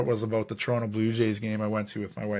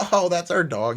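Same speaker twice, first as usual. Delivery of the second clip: mock chirpy mom; 6 semitones higher than usual. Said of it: "the mom was like".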